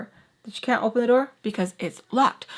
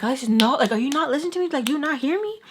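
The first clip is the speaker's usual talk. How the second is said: higher-pitched, imitated nagging challenge; acted indignant scolding; 5 semitones higher, much faster than usual. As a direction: retell a scolding, pitch climbing on the questions. Acted quarrel over the sugar.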